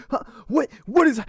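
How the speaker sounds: sudden high, loud startled yelp; startled villain. Acted. Bully startled by his falling pants, high yelp. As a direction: blurt it out, caught with his pants down.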